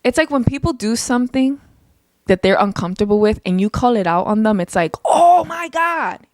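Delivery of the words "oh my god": drawn-out, high-pitched mock outrage; hammed-up horrified exclamation; mock overreaction. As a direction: stretch it out high like a drama queen. Mimicking people overreacting when called out.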